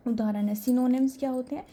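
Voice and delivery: ordinary delivery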